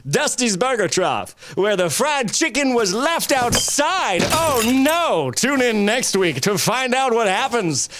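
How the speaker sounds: drawn-out cliffhanger read; slow dramatic announcer. Acted. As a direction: stretch it like a serial teaser.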